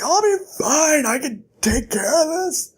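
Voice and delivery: high, strained, halting voice; acted hurt whine; pained reassurance. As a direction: insist through mock pain. Bast, mouth hurting, reassures Kvothe.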